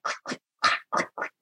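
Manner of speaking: small unvoiced mouth clicks; soft, sparse click-clack noises; imitated clicking. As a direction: imitate faint mechanical clicking. Imitates little noises heard.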